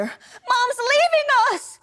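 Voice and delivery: high, loud, drawn-out panicked cry; child's alarmed wail. Acted. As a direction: wail it like a frightened child, stretching it out. Child runs to sister in alarm.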